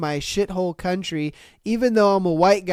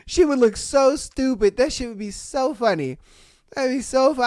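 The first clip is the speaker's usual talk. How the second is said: normal talking voice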